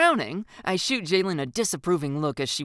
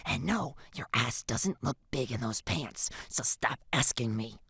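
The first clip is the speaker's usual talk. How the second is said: hushed, frustrated reply; frustrated huff; 2 semitones lower, 5 dB quieter than usual. A narrator's reading. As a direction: huff it out under your voice. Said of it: "I huff in frustration".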